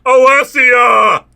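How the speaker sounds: loud, drawn-out high shout; calling out. A narrator's reading. Man calling after departing woman, loud shout. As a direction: shout the name after someone leaving. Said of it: "I call".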